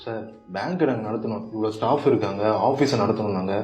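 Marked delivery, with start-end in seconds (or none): none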